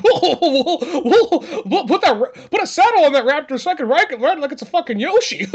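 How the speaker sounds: high, hammed-up whooping buffoon; bumbling idiot. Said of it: "just this bumbling idiot".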